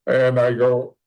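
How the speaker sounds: usual speaking voice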